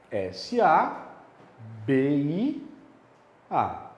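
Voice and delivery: "S A", "B I", "A" said as separate paused chunks; spelled out syllable by syllable. Shown as a demonstration. Teacher divides the word into syllables, paused pieces. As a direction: break it into slow pieces with pauses. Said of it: "Vamos dividir?".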